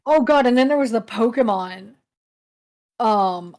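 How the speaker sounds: normal talking voice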